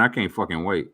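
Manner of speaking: ordinary talk, nothing marked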